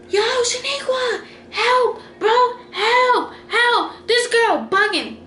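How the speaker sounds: high, desperate pleading call, slowed and strained; frantic cry for help. Retold or imitated. Friend cries out to Shaniqua for help, high panicked wail. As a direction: wail for your friend like you're losing the fight. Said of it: "So she was like".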